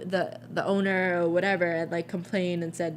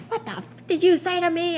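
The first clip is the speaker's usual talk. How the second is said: high, sharp mock outrage; angry customer; 11 semitones higher, 4 dB louder than usual. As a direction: whip round and confront him, mock furious. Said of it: "she's like".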